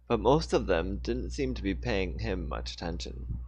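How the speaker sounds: ordinary delivery, nothing marked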